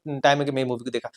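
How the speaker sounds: ordinary delivery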